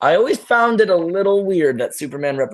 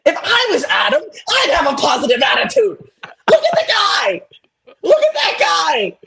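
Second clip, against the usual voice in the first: hammed-up ecstatic yell, far higher pitched; mock elated shouting. Comic rant imagining being Adam, mock elated shouting. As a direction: shriek it in over-the-top, stretched-out joy.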